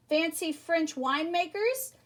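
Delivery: ordinary delivery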